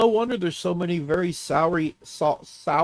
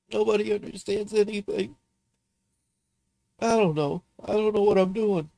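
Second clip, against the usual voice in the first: tearful outburst; 5 semitones higher than usual. Real, not acted. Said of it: "mock me for crying on stream".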